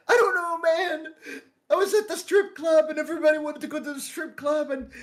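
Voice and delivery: put-on high, tearful drunk wail; mock crying. Drunk friend explains his night at the strip club, mock-crying. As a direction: blubber the explanation, half laughing. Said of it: "Why are you fucking crying?".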